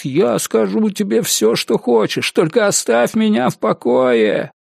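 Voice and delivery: broken pleading suspect. Acted. Worn-down suspect gives in to interrogator, high pleading.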